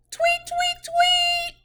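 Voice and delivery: high chirpy tweeting like a little bird; bird chirp. Acted. Teacher chirping like a bird for the children.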